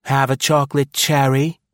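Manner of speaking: high, loud, sweetly cruel coo. A narrator's reading. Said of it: "she cooed cruelly".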